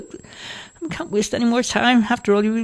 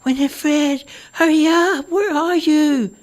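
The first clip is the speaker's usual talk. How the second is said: put-on high, loud old woman's call; 5 dB louder, slower than usual. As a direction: call her daughter again, impatient and high. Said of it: "continued to call out".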